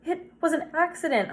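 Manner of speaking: put-on high-pitched, distressed boy's explanation; upset protest. A narrator's reading. Boy sickened by what happened, upset protest. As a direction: plead innocence in a high voice. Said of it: "sickened by what had happened".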